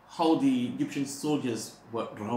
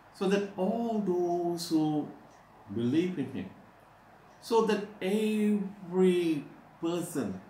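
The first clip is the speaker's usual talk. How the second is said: higher, slower impassioned preaching; rising preaching; 6 semitones higher than usual. Acted. Preacher building to a climax before the congregation. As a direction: build each "so that" into a call.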